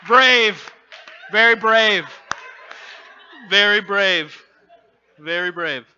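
high, loud calls of admiration, easing off; loud crowd praise. Retold or imitated. Whole audience applauds the limping boy, calling out praise. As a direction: call it out over applause.

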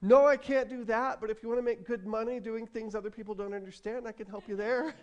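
high, lightly acted brush-off; mock answer from a personified job; engineering career replying. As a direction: refuse loudly, then offer the consolation. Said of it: "your engineering career is like".

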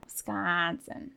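Wisconsin with a drawn-out open A, slow; long A in Wisconsin. Shown as a demonstration. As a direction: stretch the A in "Wisconsin". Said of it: "the A is a little longer".

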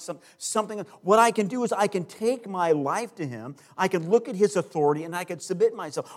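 loud, driving preacher's build; preaching. Acted. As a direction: press the point home to the congregation. Preaching builds to full force.

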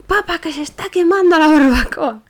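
high, loud, frantic cry down the phone; panicked shout. Retold or imitated. To her father on the phone, panicked high shout. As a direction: yell for help as if it's on fire.

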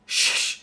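soft, drawn-out voiceless shhh; quiet hissed shushing sound; long shush. Retold or imitated. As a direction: hush them with a long "shhh". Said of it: "on the screen goes".

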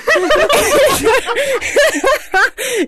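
a long squealing laugh; high laughing fit. Real, not acted. Breaks up laughing.

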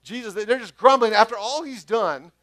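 excited preacher; animated and emphatic. Real, not acted. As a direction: raise your voice as the story builds. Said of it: "just do your best grumbling voice for me".

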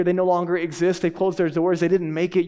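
ordinary talk, nothing marked

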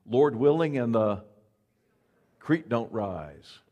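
drawled country saying, "creek" slowed; a Southern-country "Lord willing and the creek don't rise"; old Southern folk saying, slow and loud; folksy saying. Retold or imitated. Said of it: "a saying that I grew up hearing".

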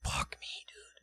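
breathy whispered curse; whispering. Retold or imitated. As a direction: whisper it under your breath. Whispered reaction.

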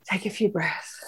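breathy whispered cue to breathe; soft whispered instruction. Shown as a demonstration. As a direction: hush it like a breath coach. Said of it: "guiding a breath pause".

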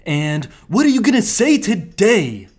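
commercial voice. Acted. Co-host doing the radio station commercial voice, loud. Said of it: "The commercial voices".

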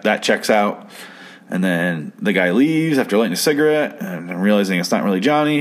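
normal talking voice

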